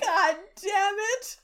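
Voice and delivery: mock-groaning God damn it; exasperated John. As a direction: drag it out in fond defeat. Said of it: "And John was like".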